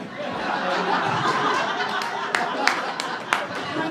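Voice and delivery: loud laugh. Real, not acted. Burst of laughter at the proposal story.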